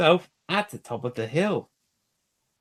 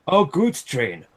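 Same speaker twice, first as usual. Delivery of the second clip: louder and slower grumble with each repeat; a proud engine's indignant moan, growing louder; grumbling; 7 dB louder, slower than usual. A narrator's reading. Gordon complains about pulling dirty coal trucks, building grumble. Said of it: "he grumbled".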